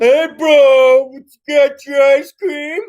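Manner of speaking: bully voice. Retold or imitated. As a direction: taunt him like a schoolyard bully.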